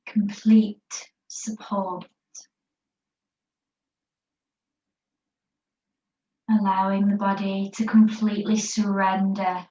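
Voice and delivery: calm, unhurried yoga teacher voice guiding a rest pose; guided meditation. Acted. Yoga teacher guiding the class in child's pose, guided meditation voice. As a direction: ease the class into stillness, word by word.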